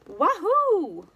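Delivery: high, loud gleeful whoop. A narrator's reading. Duck cheers with delight on the tractor, whooping. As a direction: let out a joyful cheer. Duck's joyful thought.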